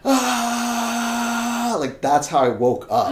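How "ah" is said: high, drawn-out mock scream; long high "Ah!" held back from full volume; imitated waking scream. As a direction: act out waking from a nightmare screaming. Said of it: "screaming at the top of my lungs".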